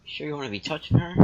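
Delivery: loud, high, mock alarm; teasing shout. Real, not acted. Gamer yelling at his character in fake alarm. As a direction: yell it at the screen in jest. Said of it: "She doesn't look so hot".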